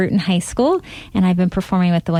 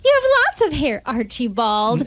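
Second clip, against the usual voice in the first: high, sweet, doting voice; dotty wife; 10 semitones higher than usual. Acted. Wife sweetly misunderstands her husband, high and doting. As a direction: coo at him without listening.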